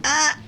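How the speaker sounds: imitated silent toddler cry, very high; mock silent baby cry. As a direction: squeeze out a tiny breathless wail.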